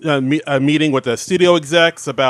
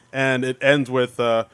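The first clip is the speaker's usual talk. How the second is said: usual speaking voice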